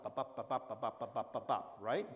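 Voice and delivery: even, steady bop on each beat; beat tapped out. Shown as a demonstration. Lecturer showing students the verse beat, even rhythmic bops. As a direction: tap out the iambic pulse evenly. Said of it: "a regular beat".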